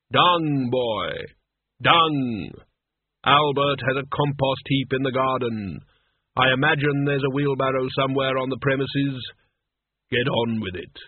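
Death giving curt orders to his apprentice; weighty, commanding Death voice; stern Death. Acted.

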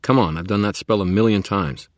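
loud exasperated protest. Acted. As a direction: push back, loud and annoyed. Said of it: "I protested".

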